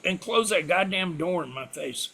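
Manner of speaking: ordinary delivery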